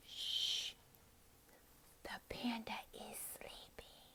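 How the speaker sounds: slow, very soft whisper; hushed whisper. Shown as a demonstration. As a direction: whisper so as not to wake someone. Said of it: "and you're like".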